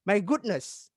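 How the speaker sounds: "My goodness" lifted on "goodness", then dropping. Shown as a demonstration. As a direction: exclaim "My goodness" with a lifted tune. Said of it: "dan juga seperti ini".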